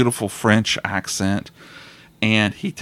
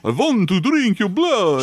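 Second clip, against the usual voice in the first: a hint of a corny vampire, higher; lightly mock the classic vampire line; cheesy vampire; 9 semitones higher than usual. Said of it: "there's none of this".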